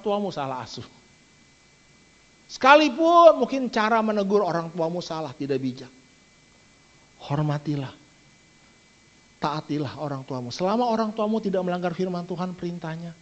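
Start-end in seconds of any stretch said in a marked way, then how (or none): none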